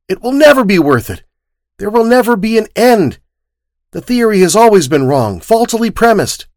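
impassioned protest. Acted. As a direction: throw the theory back at him, NEVER hit hard. Boy argues passionately.

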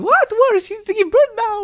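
very high, loud mock-accented shock; shocked mother-in-law impression. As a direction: imitate mom aghast in her accent. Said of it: "you try to imitate my mom's accent".

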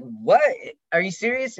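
loud what, then high are you serious; surprised disbelief. Retold or imitated. Woman says she never kissed a girl, high surprised disbelief. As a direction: gasp it out in happy shock. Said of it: "and then I was like".

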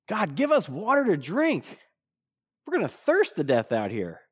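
mock whine with swooping pitch; whining, tinge of anger. Thirsty Israelites complain to God, whining with a tinge of anger. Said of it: "a tinge of anger in their voice".

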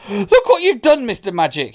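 very high, loud start, dropping away; outraged shout. Acted. Hamish accuses Mister Majika over his teeth, shouting. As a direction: cry out in accusation. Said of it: "he shouted".

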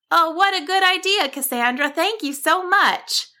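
high, delighted bird voice; happy. A narrator's reading. Bird thanks Cassandra for the idea, happy. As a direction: gush thanks in a high chirp. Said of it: "Barbara said happily".

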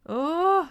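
high, loud "oh" of alarm; startled exclamation. A narrator's reading. Bob hears about the bees, startled exclamation. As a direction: exclaim in sudden shock. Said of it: "udbrød han".

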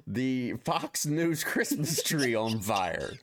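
ordinary talk, nothing marked

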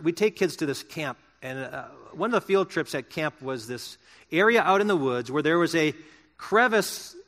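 normal talking voice

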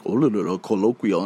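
lightly voiced dull British guy; low mumbled imitation of a boring speaker; a hint of a droning Englishman; mock boring Brit. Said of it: "this boring-ass British guy that was like".

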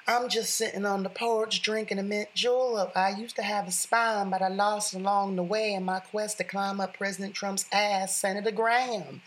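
lampoon a senator in a hammed-up high Southern accent; Lindsey Graham Southern accent. Host mocks the senator in a high Southern accent. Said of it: "That was my best Southern accent".

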